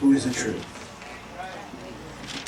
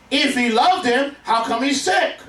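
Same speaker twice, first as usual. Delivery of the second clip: mock doubting question; 8 semitones higher than usual. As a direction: press the doubt harder the second time. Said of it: "who said".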